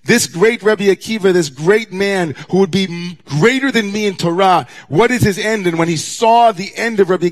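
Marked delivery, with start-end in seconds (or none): 0.0-5.6 Moses pleading. Retold or imitated. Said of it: "Moses, in a dreamlike sequence in the Talmud, says".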